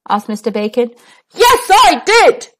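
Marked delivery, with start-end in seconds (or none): 1.3-2.5 loud, high shout. Acted. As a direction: shout it so she hears. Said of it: "shouted Mister Noisy at the very top of his voice".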